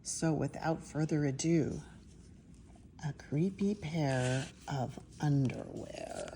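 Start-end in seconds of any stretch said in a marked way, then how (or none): 3.1-6.3 creepy whisper. Acted. Spooky title reading.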